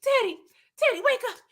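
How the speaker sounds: high, frantic mock pleading; desperate Franklin. Franklin begging dying Teddy for the password, high frantic plea. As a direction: shake him awake, panicking. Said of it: "He over there with Dan".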